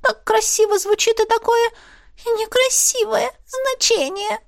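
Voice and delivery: high, sulky creature voice; grumbling little animal; squeaky otter voice. A narrator's reading.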